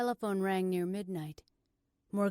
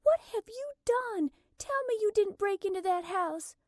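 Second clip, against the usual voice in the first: high, loud, appalled demand; alarmed scolding; 13 semitones higher, 7 dB louder than usual. Acted. Morgan to her arrested sister, loudly appalled. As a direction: scold her in shocked dismay.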